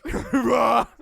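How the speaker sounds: high, loud raging roar; mock rage roar. Co-host imagines dad asked for homework help, roaring. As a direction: explode in a hammed-up dad roar. Mimics enraged dad smashing things.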